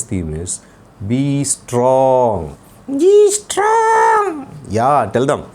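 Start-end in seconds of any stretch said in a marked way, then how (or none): 2.9-4.5 put-on high, slow child puppet voice; puppet boy voice. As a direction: play the cheeky little boy puppet. Puppet echoes theme.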